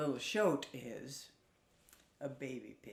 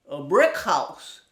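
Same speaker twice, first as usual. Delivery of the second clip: proud piglet; 13 dB louder, slower than usual. Acted. Piglet answers.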